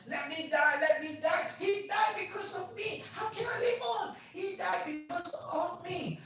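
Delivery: high, loud anguished yell; retold screaming. Patient screaming whenever awake, anguished yell. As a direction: yell it out in despair. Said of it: "she would scream and yell".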